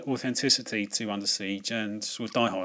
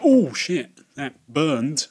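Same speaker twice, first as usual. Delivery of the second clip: a raised, louder mock gasp of a stung villain; higher, louder imitating voice; mock impressed villain; 3 semitones higher, 9 dB louder, much slower than usual. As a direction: react like someone who just got burned, hammed-up. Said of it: "the guy in the other room was going".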